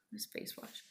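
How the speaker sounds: breathy whispered aside; whispered afterthought. Real, not acted. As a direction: drop to a whisper.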